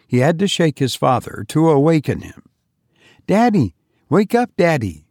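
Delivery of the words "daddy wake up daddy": put-on higher child's voice, pleading and excited; a boy begging for help on a big day; eager little boy. Johnny pleading with his mother, high and eager.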